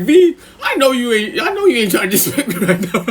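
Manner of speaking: high-pitched incredulous warning, laughing; Capone. Retold or imitated. Offended neighbor at the window, mock incredulous high voice. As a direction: warn him high, laughter creeping in. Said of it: "Capone be on the window looking at him".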